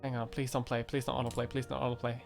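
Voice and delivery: usual speaking voice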